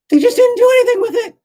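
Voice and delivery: high, loud exasperated cry; exasperated outburst. Real, not acted. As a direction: throw your hands up and yell it high. Exasperated outburst about the Greeks.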